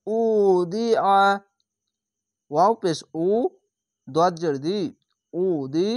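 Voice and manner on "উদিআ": উদিআ slow, আ squeezed thick from the throat; heavy throat ain. Shown as a demonstration. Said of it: "আইনটিকে মোটা করে গলা চিপে পড়বেন". (উদিআ গলা চিপে মোটা করে)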